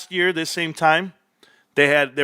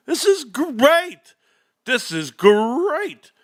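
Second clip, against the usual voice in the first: savoring delight; 8 semitones higher, much slower than usual. Real, not acted. Delighted at earnings per share.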